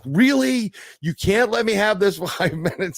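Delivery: high, incredulous, half-joking protest; mock exasperation. Joking to the nurses about being interrupted, high mock exasperation. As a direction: whine it up high like you can't believe it. Nurses working near groin.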